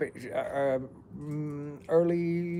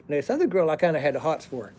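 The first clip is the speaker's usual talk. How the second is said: ordinary talk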